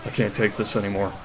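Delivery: low, quiet, defeated monotone; low deadpan misery; despairing talking dog. Acted. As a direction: drop low and plead wearily between pauses. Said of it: "dog is still looking pissed as ever".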